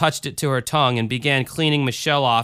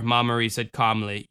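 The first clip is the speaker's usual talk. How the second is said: ordinary delivery, nothing marked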